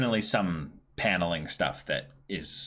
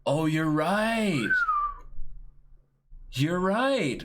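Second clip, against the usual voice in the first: surprised; 13 semitones higher, much slower than usual. Real, not acted. Sudden realisation.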